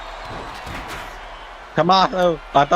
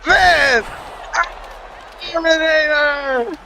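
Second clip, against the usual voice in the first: slow, higher move-name call; announcer call; 6 semitones higher, slower than usual. Pretend.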